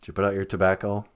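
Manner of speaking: a deep-voiced partner checking on the tobacco. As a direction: ask like a gruff partner. Acted partner voice.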